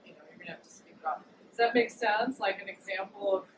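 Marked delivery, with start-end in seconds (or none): 0.0-1.2 hushed, urgent whisper; fast whispered advice; whispered insistence. Retold or imitated. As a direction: whisper it firmly, like a secret warning. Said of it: "Uranus was like".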